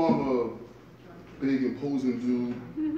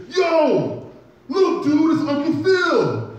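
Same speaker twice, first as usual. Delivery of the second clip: excited millennial fan; 13 semitones higher, 8 dB louder, much slower than usual. Retold or imitated. Millennial fan spotting him.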